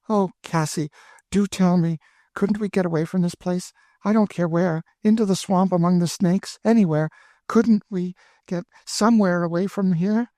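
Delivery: put-on high, anxious girlish pleading; pleading young girl. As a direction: beg her to run away with you. Emmeline begs to escape.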